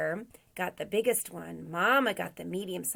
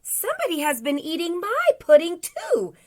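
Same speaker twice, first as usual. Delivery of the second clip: high, loud, indignant storybook mother bear; Mama Bear's voice; 9 semitones higher, 9 dB louder than usual. A narrator's reading. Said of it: "in a medium-sized voice".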